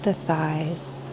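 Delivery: calm body-scan voice, short phrases between long silences; guided meditation. Acted. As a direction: lead them gently through the body, leaving space to breathe.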